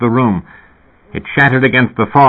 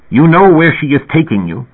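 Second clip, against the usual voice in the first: higher, louder accusation; storyteller's raised, indignant old man; furious; 8 semitones higher, 5 dB louder than usual. A narrator's reading. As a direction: press the point angrily at the boy. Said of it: "Elias said furiously".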